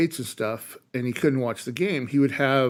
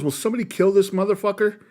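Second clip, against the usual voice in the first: higher, faster imitation of an indignant star; quick, raised-pitch mock plea to the room; mock outrage; 7 semitones higher, faster than usual. Danny DeVito onstage responding to a heckler, mock outrage. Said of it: "And then he goes".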